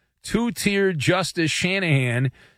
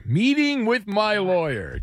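slow, higher, teasing repeat; mocking echo; 4 semitones higher, slower than usual. Host mocks the caller's lawsuit, slow teasing echo. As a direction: drag it out like he smells money.

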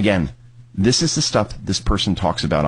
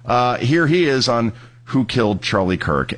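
usual speaking voice

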